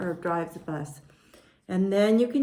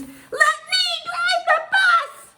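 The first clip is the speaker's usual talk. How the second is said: high, slow pigeon scream; screaming tantrum; 6 dB louder, slower than usual. Acted. Pigeon having a temper tantrum, high screamed demand. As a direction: shriek it out word by word in a tantrum. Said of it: "And he screams".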